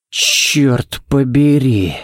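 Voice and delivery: slow, breathed-out oath; exhaled curse. A narrator's reading. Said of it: "Выдохнул Балок". (медленно выдохнутое ругательство)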